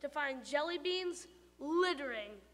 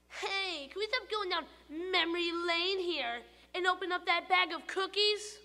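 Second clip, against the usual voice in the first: much higher, louder squeaky tooth voice; tooth calling out; 5 semitones higher, 4 dB louder than usual. Acted. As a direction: blurt it from inside the mouth.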